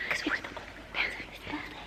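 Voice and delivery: quick, barely audible whisper. Real, not acted. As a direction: whisper it fast, as a hushed aside. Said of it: "I also don't know why we're whispering".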